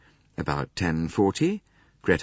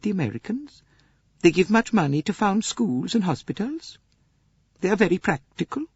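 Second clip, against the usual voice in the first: much higher, gentle Swedish lady; Greta Olson voice; 12 semitones higher than usual. Acted. Swedish lady answering Poirot's questions, high gentle voice. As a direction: lift into a mild, earnest Swedish companion.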